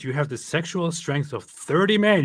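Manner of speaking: booming hammed-up permission; mock decree. Allah addressing his prophet, loud mock decree.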